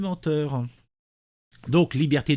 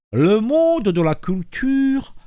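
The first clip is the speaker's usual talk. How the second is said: slow, louder pursed-lip reading; 8 semitones higher, 5 dB louder, slower than usual. Shown as a demonstration. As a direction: read with the mouth pinched tight. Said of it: "la bouche en cul de poule".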